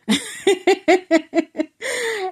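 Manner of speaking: a high, bursting laugh of pure joy; delighted laugh. Real, not acted. Retelling the man's sweet reply to the bus, delighted laugh. Man answers "baby" warmly.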